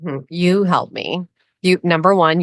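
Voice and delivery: ordinary talk, nothing marked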